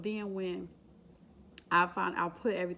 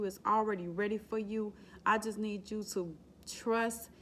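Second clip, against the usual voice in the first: higher, earnest exhortation; preaching encouragement; 4 semitones higher than usual. Real, not acted. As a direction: preach gently to the viewers. Encouraging viewers in faith.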